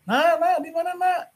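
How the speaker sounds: high, searching call for the son; father calling his son from the doorway, high and searching. Acted. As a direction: call out across the house for a missing child.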